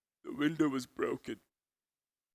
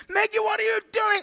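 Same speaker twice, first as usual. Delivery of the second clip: very high, loud frantic shout; hammed-up shrieky outburst; panicked exasperation; 7 dB louder than usual. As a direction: demand an answer in utter alarm. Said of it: "We're panicking at the robots".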